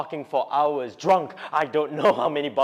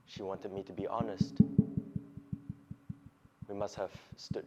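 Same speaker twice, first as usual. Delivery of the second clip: soft, hushed confession; subdued recollection; 3 semitones lower, 8 dB quieter than usual. Acted. Man recalling their long silent stare, subdued. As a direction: let it sink very quiet, with long pauses. Subdued memory of saying nothing.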